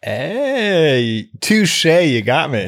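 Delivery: playful touche. Real, not acted. Conceding the joke.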